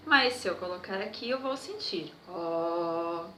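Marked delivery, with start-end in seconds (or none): 2.3-3.3 low held "oh" from the chest. Shown as a demonstration. Teacher has children feel the chest vibrate, low held note. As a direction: sustain a low, steady "oh". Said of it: "façam um som grave".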